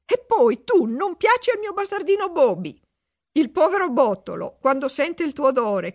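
high indignant scolding; angry princess. A narrator's reading. Princess scolding the seagull prince for sickening gifts, angry. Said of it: "disse adirata al principe dei gabbiani".

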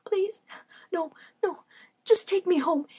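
soft, high tearful begging; sobbing plea. Acted. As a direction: beg through sobs, quietly. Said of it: "Pleasure sobbed over and over again".

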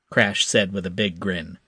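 usual speaking voice

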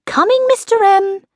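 high sing-out to someone below; calling out. A narrator's reading. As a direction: call back to a waiting husband. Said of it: "called Missus M".

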